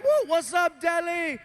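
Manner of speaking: high excited shout-out to the Delhi crowd; hyped greeting. Retold or imitated. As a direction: hype up the crowd.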